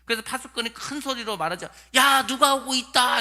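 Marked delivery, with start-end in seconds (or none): 2.0-3.2 loud, slow call from the wall; watchman's shout. Retold or imitated. Watchman on the wall shouting to the king. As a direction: call out across the distance. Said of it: "이렇게 소리를 지릅니다".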